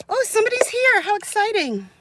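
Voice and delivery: high sudden surprised remark. Real, not acted. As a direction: gasp and perk up. Reacts to someone arriving.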